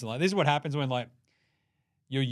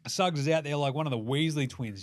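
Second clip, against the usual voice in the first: ordinary talk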